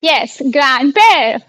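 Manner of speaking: ग्रैंडपेर said slowly and clearly, French grand-père; careful slow teacher model; slow model word. Shown as a demonstration. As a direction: model ग्रैंडपेर for the class to copy. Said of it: "repeat कीजिए".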